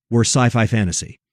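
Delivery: usual speaking voice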